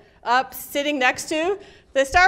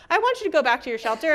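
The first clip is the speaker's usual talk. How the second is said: usual speaking voice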